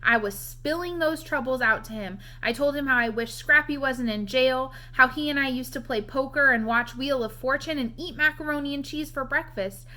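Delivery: ordinary delivery, nothing marked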